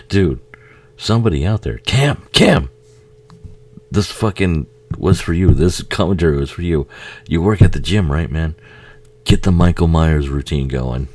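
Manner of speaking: loud, excited shout-out to a friend; calling out to his gym buddy Cam. Real, not acted. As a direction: call out to Cam.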